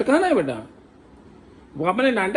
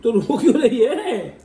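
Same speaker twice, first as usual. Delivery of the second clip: laughing tease; 6 semitones higher, 4 dB louder, slower than usual. Real, not acted. Teasing crying wife, laughing.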